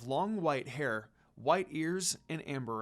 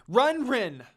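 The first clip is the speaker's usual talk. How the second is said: urgent shout, higher and louder; 8 semitones higher, 9 dB louder than usual. Acted. Kagome warning Rin of the bear, an urgent shout. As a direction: yell it to save her. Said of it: "Kagome shouted".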